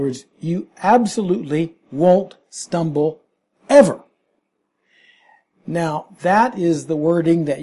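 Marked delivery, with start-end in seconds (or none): 3.7-4.0 ever punched much higher and louder; emphatic ever. Real, not acted. Preacher insisting believers will never stumble, punched ever. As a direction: drive home the word ever. Preacher hammers the Greek word.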